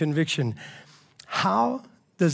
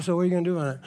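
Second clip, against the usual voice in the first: cool and casual; much faster than usual. Shown as a demonstration. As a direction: toss it off coolly, no yelling. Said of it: "We actually say it more cool".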